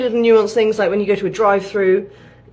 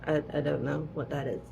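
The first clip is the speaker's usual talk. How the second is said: hushed, blank confusion, much softer; puzzled shop assistant; 4 semitones lower, 14 dB quieter than usual. Retold or imitated. Imitating a shop assistant baffled by British words, hushed. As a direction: mimic a baffled clerk under your breath.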